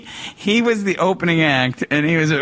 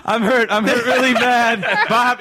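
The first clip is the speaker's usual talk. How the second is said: mock dazed groan; 6 semitones higher than usual.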